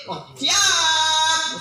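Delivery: eager reply. Retold or imitated. Acts a phone reply.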